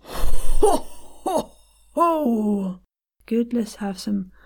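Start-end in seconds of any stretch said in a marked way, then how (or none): 0.0-2.8 louder, higher belly-laugh drill; Christmas ho-ho-ho. Shown as a demonstration. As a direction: punch out airy ho's from the diaphragm. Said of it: "One more from you".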